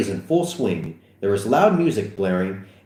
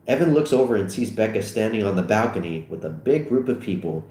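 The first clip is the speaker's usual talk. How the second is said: ordinary delivery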